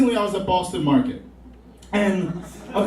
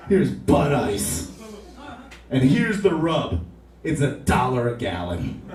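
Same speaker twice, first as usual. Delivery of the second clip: lightly voiced, low corporate pitchman; a hint of a low-voiced salesman; company spokesman; 5 semitones lower than usual. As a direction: play a beer rep talking down to a customer, pitched low. Beer company spokesman addresses a customer.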